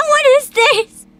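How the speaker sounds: normal talking voice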